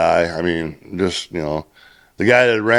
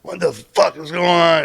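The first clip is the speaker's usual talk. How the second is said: high, drawn-out mock scream without much volume; retold screaming; 11 semitones higher than usual. Roadmaster throwing his hard hat at the crew, imitated screaming. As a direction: imitate a boss losing his temper. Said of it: "and start screaming".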